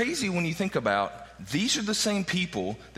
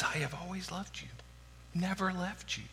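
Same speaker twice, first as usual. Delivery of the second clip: tender; 8 dB quieter than usual. Acted. God's reassuring words quoted.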